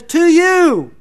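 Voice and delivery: "To you" rung out with strong emphasis; very emphatic. Acted. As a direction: punch out "To you" at a high, loud pitch. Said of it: "very emphatically he says".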